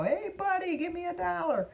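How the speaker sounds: put-on high kid's voice begging for a dollar; squeaky child asking Buddy for money; high-pitched kid voice, pleading for a dollar; little kid asking. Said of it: "another kid would go".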